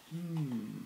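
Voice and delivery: usual speaking voice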